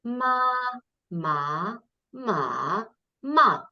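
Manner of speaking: "ma" four times through first, second, third and fourth tone; four Mandarin tones. Shown as a demonstration. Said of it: "if I was going to say the four different tones".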